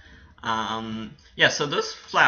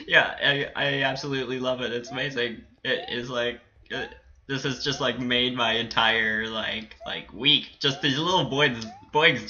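normal talking voice

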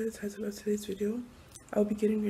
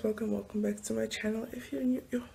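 ordinary delivery

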